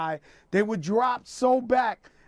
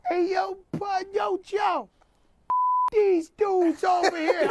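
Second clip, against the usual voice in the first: little voice; 11 semitones higher than usual. Retold or imitated. Rapper imitating a little voice out of nowhere, high little voice. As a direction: imitate a small high-pitched voice calling out. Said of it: "you hear one little voice".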